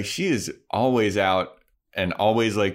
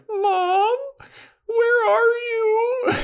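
high, drawn-out wail for mom; whiny, stretched-out mock child's cry; lost kid wailing; 3 dB louder, much slower than usual. Voices lost child calling mother.

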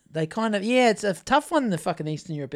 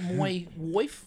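Irish accent; 4 semitones lower, 9 dB quieter than usual. Shown as a demonstration. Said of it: "He, he does it".

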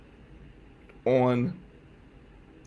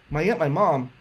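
plain reading voice

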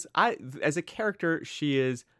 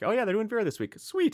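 Sweet squeaked much higher; mock fan delight; 6 semitones higher than usual. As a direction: play an eager mystery fan, delighted.

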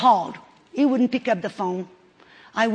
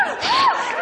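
sharp high gasp of joy; excited gasp. Real, not acted. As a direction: gasp as if you can't believe it. Overjoyed at the answered prayer.

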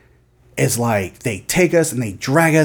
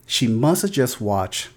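ordinary talk, nothing marked

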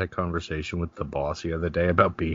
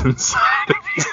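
words dissolving into rising laughter; cracking up; 4 dB louder, faster than usual. Real, not acted. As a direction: lose it laughing on the last words. Cracks up delivering running joke.

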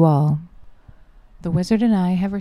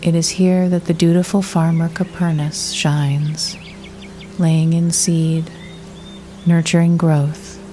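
guided meditation. Acted. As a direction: guide the listener gently into the imagery, unhurried.

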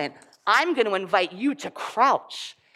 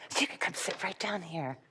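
whisper; 2 semitones lower, 12 dB quieter than usual. Real, not acted. Whispering to children gathering on the floor. Inviting kids to sit quietly.

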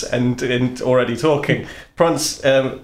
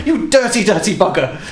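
high mock scold; 6 semitones higher than usual.

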